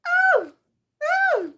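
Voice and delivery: high, loud exclaimed oh and ah; short, high, hammed-up yelps; mock cries. Partner realizing what birds are, mock amazed yelps.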